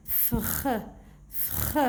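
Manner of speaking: "Fr" as a voiceless, rasped blend; blended consonants. Shown as a demonstration. Teacher reading syllables for children, blended consonants. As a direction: sound out "Fr", softly, with no letter names. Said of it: "regardez comment je vais lire".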